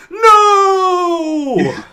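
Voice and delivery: long, high, loud wail of "no"; anguished cry. Acted. Player voices Darwin crying at the monkey, anguished cry. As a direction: cry out in drawn-out, hammed-up grief. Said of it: "as he cries at the monkey".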